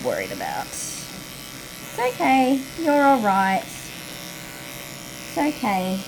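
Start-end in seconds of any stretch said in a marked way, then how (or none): 0.7-3.6 slow, gentle reassurance to a dog; calm the nervous dog, slowly; soothing. Real, not acted. Soothing dog during grooming. 3.6-6.0 slow, gentle reassurance to a dog; calm the nervous dog, slowly; soothing. Real, not acted. Soothing dog during grooming.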